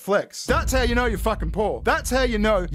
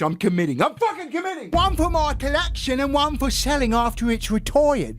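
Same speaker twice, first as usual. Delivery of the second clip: a hammed-up British read, pitched up in places; mock British accent; 4 semitones higher than usual. Streamer reads the Lego collector's tweet in a mock British accent. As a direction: play the smug British collector bragging. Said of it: "I love that he does the accent".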